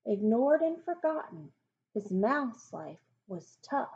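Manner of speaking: ordinary delivery, nothing marked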